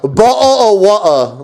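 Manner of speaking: high, drawn-out Cockney imitation; exaggerated Cockney with dropped t's; slow, high-pitched American go at Cockney; mock Cockney accent. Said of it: "they're the ones that do the".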